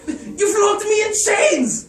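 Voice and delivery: very high, loud, slow shout of alarm; panicked shout. Acted. Garrett to Stendhal, a panicked shout. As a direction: shout it in disbelief.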